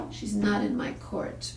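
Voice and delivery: ordinary talk, nothing marked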